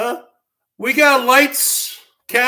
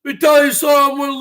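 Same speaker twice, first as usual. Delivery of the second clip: loud, fast rant opener; ranting shout; 3 semitones higher, 7 dB louder than usual. Real, not acted. Streamer yelling at his viewers, loud and fast. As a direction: bark it at the chat.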